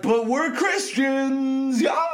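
drawn-out mock shout, slow; mocking critics, slow and loud; mock loud protest. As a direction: yell it like a smug believer. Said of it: "But then you're like".

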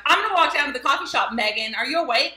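mock over-eager wake-up call; perky morning person. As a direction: act out bouncing into a friend's morning at full volume. Retold overly perky early-morning call.

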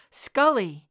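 sudden loud panicked cry; fearful shout. Acted. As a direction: call her name in panic. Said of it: "Fear as primal and shattering".

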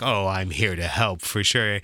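low-pitched mock-gallant man; smooth helper. Man offering to carry her fish tank, mock-gallant. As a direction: drop low, playing the obliging gentleman. Acts the helpful man in sketch.

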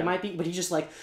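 usual speaking voice

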